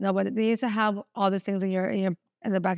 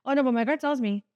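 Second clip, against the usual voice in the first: ordinary delivery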